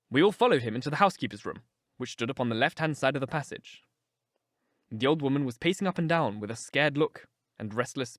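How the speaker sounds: ordinary delivery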